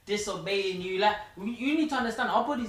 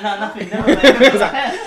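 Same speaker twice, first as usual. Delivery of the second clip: laughing; 5 semitones higher, 17 dB louder than usual. Retold or imitated. Retelling classmates' awkward brush-off.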